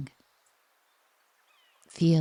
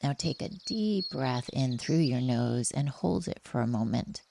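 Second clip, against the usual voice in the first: ordinary talk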